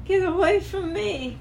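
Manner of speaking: high, cracking plea. Real, not acted. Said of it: "Your voice is cracking".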